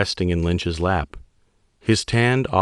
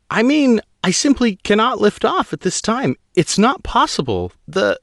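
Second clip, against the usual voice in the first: high female pilot voice, tense and pleading; a rattled pilot explaining to an admiral; young woman pilot; 4 dB louder than usual. Acted. Pilot Guano's voice.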